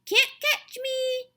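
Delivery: put-on high, drawn-out little-boy taunt; toddler's taunting holler. A narrator's reading. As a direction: holler it like a toddler running away. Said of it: "he hollered".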